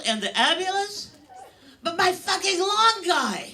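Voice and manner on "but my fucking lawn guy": outraged punchline. Real, not acted.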